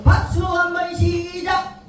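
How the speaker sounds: high, chanted clap cue with a stretched last word; calling the cue. Real, not acted. Instructor starting the group's clapping, high sing-out call. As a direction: call out the count-in on a high held note.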